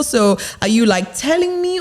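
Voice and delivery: rising high on "telling me"; unsure, high voice. Shown as a demonstration. Coach mimicking unsure speech, voice going high. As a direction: push "telling me" up like a question. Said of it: "your voice goes high".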